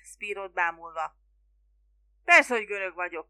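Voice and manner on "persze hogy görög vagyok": loud, punched first word; shouted reply. A narrator's reading. Spiro answering the curious fisherman, shouting. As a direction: snap back loudly at an obvious question. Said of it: "kiáltotta Spiro".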